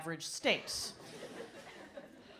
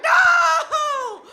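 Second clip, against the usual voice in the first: very high, loud drawn-out wail; horrified refusal; 16 dB louder than usual. Retold or imitated. Wife hearing about the cashmere gift, comically horrified cry. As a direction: wail it out in mock horror.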